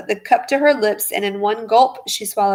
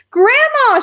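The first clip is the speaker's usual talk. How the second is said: much higher, louder "Grandma!"; shriek; 7 dB louder than usual. A narrator's reading. Wife shrieking at the shrinking grandma, shriek. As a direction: shriek in alarm. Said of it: "shrieked Missus Cranky".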